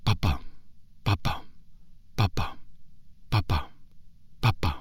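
soft fading heartbeat; slowing heartbeat. Acted. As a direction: let the heartbeat fade away softly. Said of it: "his heart beat slower".

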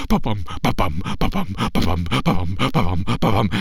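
low quick ba-bum drumbeat; deep pounding drumbeat. Acted. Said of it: "marching even quicker".

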